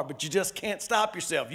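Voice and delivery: ordinary talk, nothing marked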